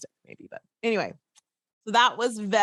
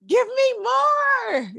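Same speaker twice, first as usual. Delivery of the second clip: high, loud, drawn-out yell; mock demanding shout; 6 dB louder, much slower than usual. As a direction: wail for more, stretching every word. Retold viewer demanding more episodes.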